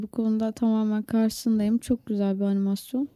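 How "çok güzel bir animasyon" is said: normal talking voice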